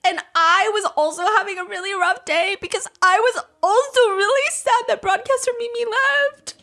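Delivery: high, whiny mock self-pity; lightly hammed-up sad whine; mock whiny complaint. Mocking Zizi crying about her own day, high and whiny.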